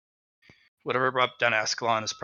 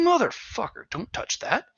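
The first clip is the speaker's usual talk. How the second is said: higher, snapping rebuke that fades softer; sharp warning; 11 semitones higher, 3 dB quieter than usual. Acted. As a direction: stop the kid from touching the meat. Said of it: "And he's just like".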